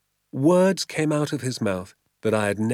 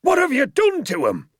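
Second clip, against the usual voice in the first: loud, much higher outraged shriek; shouted; 5 dB louder than usual. A narrator's reading. Landowner shrieking accusation at the father and doctor. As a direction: shriek it in disbelief. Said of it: "he shouted".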